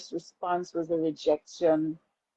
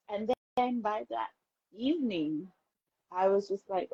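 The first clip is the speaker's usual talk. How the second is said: ordinary talk, nothing marked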